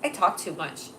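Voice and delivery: ordinary delivery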